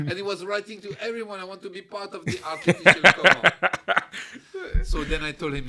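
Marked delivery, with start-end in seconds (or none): 1.4-3.4 louder laughing request; mock cellmate plea. Cellmate writing to everyone, laughing mock plea. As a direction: say it like an eager fool, laughing.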